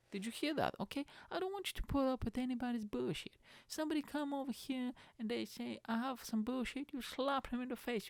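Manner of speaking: high sassy diva pep talk; put-on sassy hype voice.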